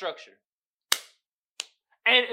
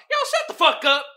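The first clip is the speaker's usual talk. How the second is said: mock snap; 8 semitones higher, 6 dB louder than usual. Snapping at imagined complainer.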